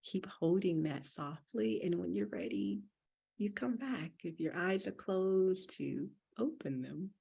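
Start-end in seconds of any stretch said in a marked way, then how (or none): none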